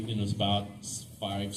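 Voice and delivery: ordinary talk, nothing marked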